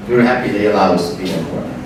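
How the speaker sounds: ordinary delivery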